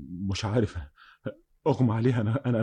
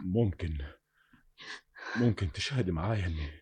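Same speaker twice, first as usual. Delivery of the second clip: low, hushed, halting; soft confession; 6 semitones lower, 8 dB quieter than usual. Acted. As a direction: confide his fear in a near whisper. Confessing softly to beloved. (اعتراف بصوت واطي)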